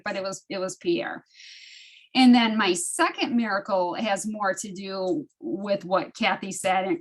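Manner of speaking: ordinary talk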